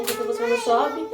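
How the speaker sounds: high mock nurse voice; quoted nurse. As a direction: lift the voice high on the nurse's words. Quoting the nurse in the delivery room. (imitando a enfermeira com voz aguda)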